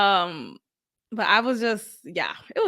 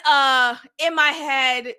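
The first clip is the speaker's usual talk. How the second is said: normal talking voice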